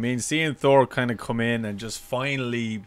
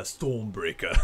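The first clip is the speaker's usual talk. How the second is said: low, slowed impression voice; Dinklage; 4 semitones lower, 4 dB quieter, slower than usual. Host can't resist naming Thor's weapon, Dinklage impression. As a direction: drop into it slowly, quieter. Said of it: "do that in the Dinklage voice".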